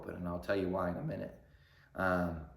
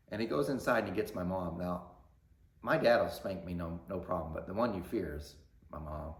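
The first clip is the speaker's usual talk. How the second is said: normal talking voice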